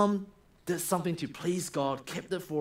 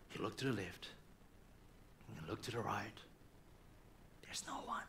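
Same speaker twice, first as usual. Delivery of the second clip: hushed, softer and lower; quiet sneaky narration fading to a whisper; 8 semitones lower, 18 dB quieter, faster than usual. Acted. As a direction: drop to a secret hush, ending in a whisper. Moses checks for witnesses.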